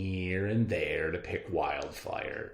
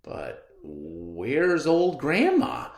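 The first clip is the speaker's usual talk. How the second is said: hunter wondering; 6 semitones higher, 7 dB louder, slower than usual. Acted. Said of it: "the hunter wondered".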